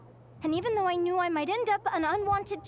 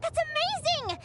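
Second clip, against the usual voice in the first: much higher, bright excited praise; delighted; 12 semitones higher than usual. Acted. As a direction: cheer her on happily.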